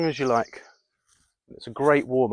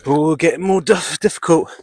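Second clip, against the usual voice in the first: louder, strained gasp of effort; straining effort; 4 semitones higher, 7 dB louder than usual. Real, not acted. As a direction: push the words out through the exertion. Straining mid push-ups.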